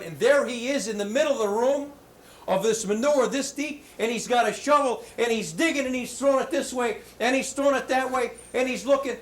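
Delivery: put-on storyteller thrilled at the boy digging, excited; excited build. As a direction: tell it with mounting excitement, voice up high. Mother finds excited boy digging.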